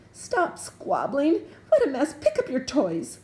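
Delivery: loud, high scolding yell; yelling mother. A narrator's reading. Gertie yelling at her toadlets, loud scolding. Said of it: "he heard her yelling inside".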